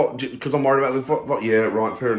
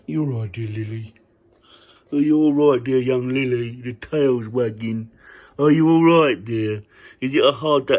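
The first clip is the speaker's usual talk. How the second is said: loud, sing-song mock concern for the dog; doting put-on voice, louder, fussing over the pet; silly dog-talk voice; 4 dB louder than usual. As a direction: talk to the dog like a fretting nanny in a put-on accent. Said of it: "Why am I talking this stupid accent?".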